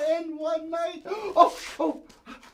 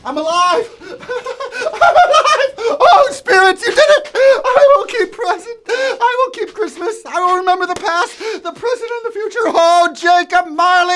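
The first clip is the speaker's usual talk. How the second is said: loud, laughing, high cries of joy; joyful shouting; 4 semitones higher, 9 dB louder than usual. Acted. Scrooge wakes alive on Christmas morning, shouting with laughing joy. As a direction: burst out with giddy delight.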